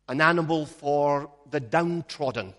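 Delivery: ordinary delivery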